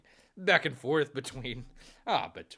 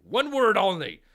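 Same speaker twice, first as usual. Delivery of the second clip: teasing shout; 10 semitones higher, 13 dB louder than usual. Acted. Taunting a rival hockey fan.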